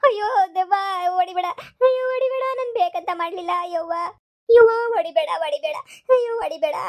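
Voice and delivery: tearful begging. Acted. Grandmother begs ghost to stop.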